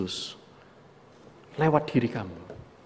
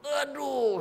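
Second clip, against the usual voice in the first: ouch; 12 semitones higher, 4 dB quieter than usual. Retold or imitated. Stepping on Lego barefoot.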